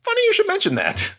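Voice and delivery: cheeky falsetto quip; mock high voice. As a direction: pitch it way up like a cartoon. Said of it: "And I'm like".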